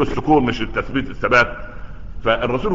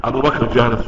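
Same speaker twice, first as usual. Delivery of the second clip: ordinary talk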